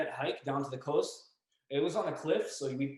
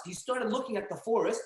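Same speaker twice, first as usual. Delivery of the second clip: usual speaking voice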